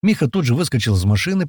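plain reading voice

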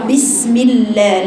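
بسم الله drawn out with a clipped lam fatha; slow wrong reading. Shown as a demonstration. Teacher shows a mistaken بسم الله. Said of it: "لا، لا".

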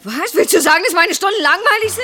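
high, indignant challenge; offended outrage. Acted. Teacher wife hearing her lessons called boring, offended. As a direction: rise from disbelief into indignation.

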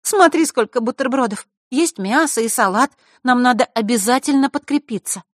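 put-on high, loud, urging girl's voice; eager girl. A narrator's reading. Diana urges her friend to eat, eager high voice. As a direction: talk her into eating, high and insistent.

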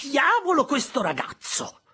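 high, loud exasperated scolding; angry outburst. Acted. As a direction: snap at the nagging boy. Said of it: "interruppe mia sorella".

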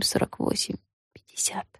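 fading to a whisper; whispered counting. A narrator's reading. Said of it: "считала их про себя".

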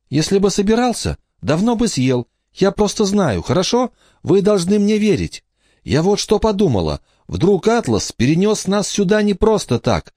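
put-on very high, earnest child's voice; insistent little girl. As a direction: plead your case in a high girlish pitch. Emma argues her case to siblings.

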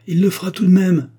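high, loud contradiction; wife's heated objection; vehement protest. A narrator's reading. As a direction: burst out in disbelief of the promise. Wife protests husband will drink.

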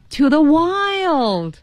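high, long-drawn "wild"; big reveal. Acted. Marty finally revealing his wish, in a big dramatic reveal. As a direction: soar upward and stretch out the last word. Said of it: "声儿一个比一个大".

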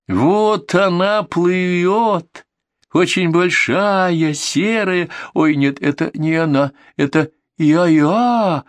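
high, slow, drawn-out excited calling; excited spotting. A narrator's reading. Friends on the bridge call out the floating thing, high and excited. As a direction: point it out, thrilled, stretching each word.

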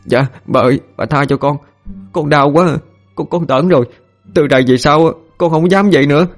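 put-on high-pitched servant boy begging for mercy; desperate pleading. A narrator's reading. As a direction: plead high and pitifully with the mistress. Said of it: "ôm chân bà mà năn nỉ". (giọng năn nỉ van xin, cao và đau đớn)

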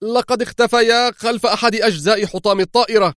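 high-pitched nervous report; agitated cry. A narrator's reading. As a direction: call it out on edge, pitched well up. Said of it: "هتف الطيار في عصبية".

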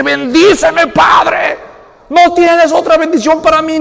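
high, loud begging shout; desperate plea. Retold or imitated. Son begging his father for a blessing, high loud shout. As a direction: beg the father with everything.

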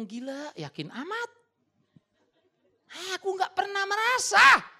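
mock-bewildered God, slow and loud; voicing God baffled. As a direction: play God, puzzled.

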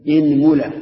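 إنملة as "inmula", loud and clear; i then u. Shown as a demonstration. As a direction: say إنملة with i on the hamza, u on the meem. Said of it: "اكسر الهمزة".

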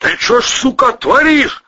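high, loud outraged shout; outraged bawl at a high pitch; furious yelling. A narrator's reading. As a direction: yell at the reckless driver. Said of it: "заорал Протасов".

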